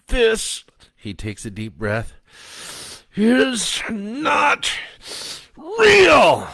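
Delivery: high, loud, forced out with effort; strained defiance. Acted. Joe fighting the starfish, high strained defiance. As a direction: push every word out through pain.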